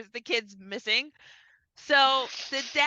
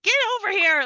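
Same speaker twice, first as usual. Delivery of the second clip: high, louder mock panic from the dad; mock panicked yell; 10 semitones higher, 5 dB louder, faster than usual. Dad panicking as daughter swims in the lake, mock yell. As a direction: call her in like a panicked father. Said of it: "he starts freaking out".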